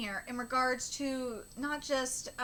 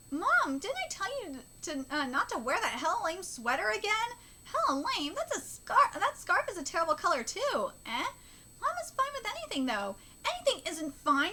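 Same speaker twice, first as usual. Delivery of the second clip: animated manga dialogue, pitch swinging; a hint of fussy child and mother; lightly voiced nagging child; 6 semitones higher than usual. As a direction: nag Mom about her clothes, lightly acted.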